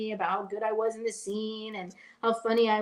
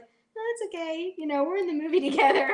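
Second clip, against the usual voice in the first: polite demurral, acted; 8 semitones higher, 4 dB louder than usual. Acts out shyly declining a photo.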